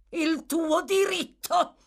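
high, loud, slow scorn; contemptuous. A narrator's reading. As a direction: spit it back with contempt. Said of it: "esclamò la signora Bumble con indicibile disprezzo".

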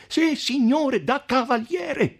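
high, emphatic retort; crying out. A narrator's reading. Count answering the podestà, crying out. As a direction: fire back high, stretching the last word. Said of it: "gridò il conte".

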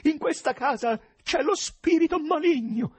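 high, loud, frantic screaming; frenzied yell. A narrator's reading. President urges investigators to seize evil spirits, frenzied yell. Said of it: "urlò frenetico il presidente".

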